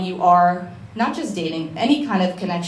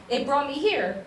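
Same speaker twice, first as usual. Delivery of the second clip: higher and softer through tears; real tearful voice; 5 semitones higher, 5 dB quieter than usual. Real, not acted. Speaker telling audience what brought her here, tearful. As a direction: push on while choked up. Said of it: "Why am I crying so much?".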